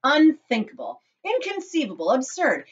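high, emphatic denials, word by word; crying out dismissively. A narrator's reading. Chocolate maker dismisses mother's fear, emphatic cry. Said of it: "cried Mister Wonka".

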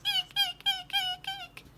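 very high squeaking bat; squeaky bat. Acted. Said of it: "said the bat".

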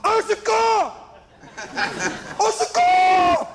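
high, loud hammed-up yell for rescue; drowning Frenchman crying for help. Comedian mimics a Frenchman in trouble, hammed-up high cry for help. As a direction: shriek it out, dragging the last cry, gurgling under. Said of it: "He goes like this, he goes".

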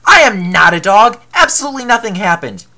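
loud, insistent protest; indignant denial. Acted. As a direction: deny it hotly and loudly. Indignant denial in a shouted quarrel.

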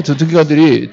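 ordinary delivery

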